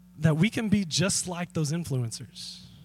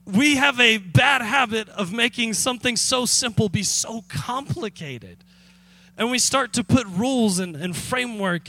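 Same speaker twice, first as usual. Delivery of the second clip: normal talking voice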